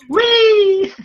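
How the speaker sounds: loud high squeal of joy; very high loud whee; cheering. Real, not acted. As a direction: celebrate the milestone. Celebrating one hour mark.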